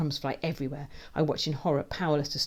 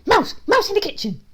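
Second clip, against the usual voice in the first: high, loud, yapping alarm; excited bark; 12 dB louder than usual. A narrator's reading. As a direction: bark it like a thrilled dog. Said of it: "he barks excitedly".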